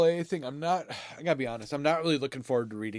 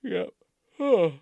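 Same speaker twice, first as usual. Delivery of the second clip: yawning mid-sentence; 7 semitones higher, 4 dB louder than usual. Real, not acted. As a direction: sigh it out through a yawn. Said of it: "I cannot stop yawning".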